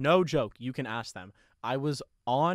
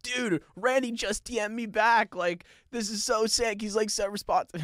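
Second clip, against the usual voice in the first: much higher, thrilled buddy voice; excited friend; 9 semitones higher than usual. Retold or imitated. Friend on the couch telling the boys Randy replied, thrilled. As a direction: gush like a starstruck teen.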